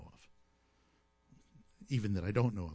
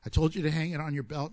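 normal talking voice

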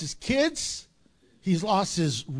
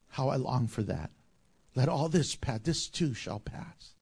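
low, hushed voice full of longing; tender longing; 6 semitones lower, 7 dB quieter than usual. Retold or imitated. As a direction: murmur it like a quiet prayer of longing. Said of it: "And he says".

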